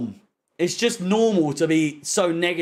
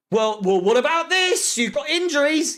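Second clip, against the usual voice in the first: mocking imitation; 7 semitones higher than usual. Fan mimicking the manager's defenders, mocking high voice. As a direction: pitch climbing into a mock objection.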